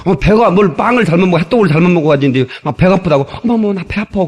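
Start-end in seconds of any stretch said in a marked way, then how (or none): 3.4-4.2 put-on Seoul girlfriend complaining. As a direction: imitate a girlfriend's tummy-ache plea. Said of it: "그러니까 또 서울 남자가". (살짝 앓는 소리 흉내)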